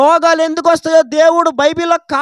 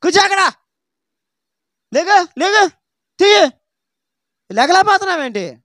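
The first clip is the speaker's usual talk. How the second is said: higher, bossy orders; rude hospital staff; 4 semitones higher than usual. Retold or imitated. Hospital staff ordering a patient about, higher and bossy. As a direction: bark at the patient like impatient staff. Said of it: "హాస్పిటల్లో ఎలా మాట్లాడతారో".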